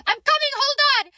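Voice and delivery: high, loud call to someone off. Real, not acted. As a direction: call out that you're on the way. Calls out while counting in the tube.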